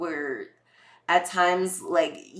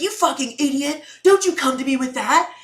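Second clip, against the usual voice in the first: higher, louder hammed-up snarl; mock rude agent; 7 semitones higher, 7 dB louder than usual. Agent insulting a customer, hammed-up angry snarl. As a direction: bark at the caller in mock fury.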